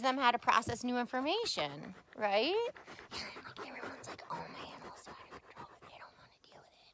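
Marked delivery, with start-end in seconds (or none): 3.1-6.8 soft breathy whisper as the dog; dog's inner voice. Acted. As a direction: whisper the dog's wise words. Voicing the dog.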